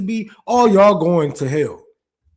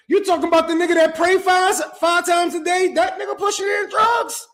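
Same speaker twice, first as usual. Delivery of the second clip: incredulous scoff; 9 semitones higher than usual. Real, not acted. Scoffing at the idea of Muhammad.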